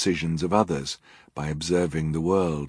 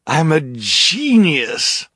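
slow, high sneering villain; 10 semitones higher, 8 dB louder, much slower than usual. Acted. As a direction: drawl it like a gloating rogue. Narrator voices villain Count Olaf.

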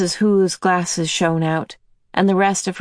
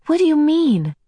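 put-on much higher, pleading girl's question; higher plaintive girl; 11 semitones higher, 3 dB louder than usual. A narrator's reading. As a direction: ask it helplessly, pleading. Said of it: "Meg asked plaintively".

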